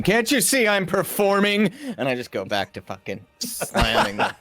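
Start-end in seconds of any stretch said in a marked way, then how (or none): 0.0-1.7 hammed-up protest of an interrupted performer; mock shout. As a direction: snap it high at a rude interrupter. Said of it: "I shout at the muscle thing".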